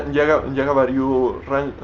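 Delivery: ordinary delivery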